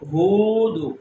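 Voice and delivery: ہود with hoo held long; long vowel. Shown as a demonstration. Teacher drills wao madda, ہود drawn out. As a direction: stretch the oo of ہود. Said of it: "ہا کو لمبا کریں گے".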